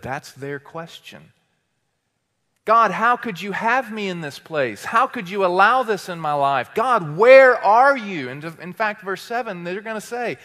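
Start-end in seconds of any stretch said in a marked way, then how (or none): 2.6-8.3 rising complaint. Acted. Israelites' complaint voiced by preacher.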